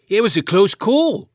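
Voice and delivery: a hint of a concerned farmer uncle checking on the lad; gentle uncle asking if the boy is hurt; kindly uncle. A narrator's reading. Uncle voiced in story.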